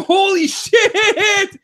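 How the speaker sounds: high, drawn-out cry breaking into laughter; amazed exclamation. Retold or imitated. Fan retells watching the grilling, whooping amazement. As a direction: stretch it out high in gleeful disbelief.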